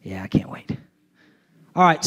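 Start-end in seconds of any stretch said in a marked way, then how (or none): none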